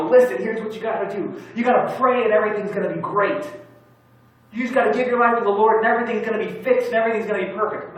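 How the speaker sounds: mocking the easy-answer preacher the speaker refuses to be; mock preachy. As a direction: parody a slick pat-answer preacher. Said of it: "No, that's dumb".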